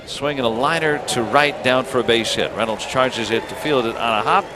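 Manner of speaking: normal talking voice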